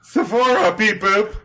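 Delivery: high-pitched beeping robot. Acted. Co-host joining in as the mall robot, high robot voice. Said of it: "robot voice".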